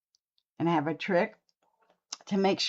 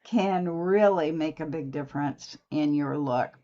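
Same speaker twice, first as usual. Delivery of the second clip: usual speaking voice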